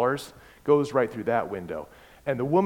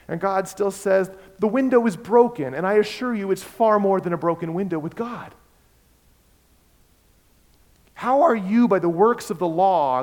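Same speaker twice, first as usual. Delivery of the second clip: preacher pressing the congregation, rising and loud; preaching at full force; 6 semitones higher, 5 dB louder than usual. Acted. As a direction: drive the point home from the pulpit.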